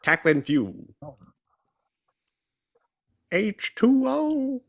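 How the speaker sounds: high, drawn-out movie-character voice; Bobby Boucher. Acted. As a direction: imitate a simple-minded water boy, stretching the words high. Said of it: "Bobby Boucher from Waterboy".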